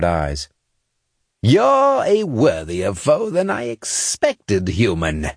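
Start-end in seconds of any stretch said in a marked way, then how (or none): 1.4-5.3 high, slow alien emperor voice; a haughty alien ruler sizing up his foe; Crota. A narrator's reading. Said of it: "Crota said".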